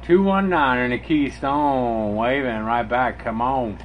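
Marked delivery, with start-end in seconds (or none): none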